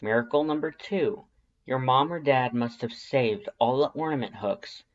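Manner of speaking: ordinary delivery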